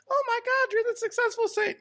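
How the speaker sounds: put-on high, starstruck little kid. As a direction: squeal it like an awestruck child. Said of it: "little Timmy comes up to me".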